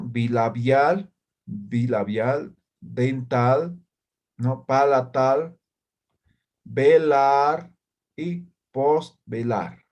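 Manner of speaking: slow, syllable by syllable: bilabial; teacher drawing out bilabial for the class; slow clear model word. Shown as a demonstration. Teacher modelling bilabial slowly for students. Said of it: "expresa en la parte superior los puntos de articulación".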